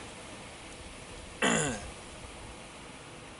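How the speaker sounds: soft breathy cough; lingering cough from the bug; just inhaled a bug. Real, not acted. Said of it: "I think I just inhaled a bug".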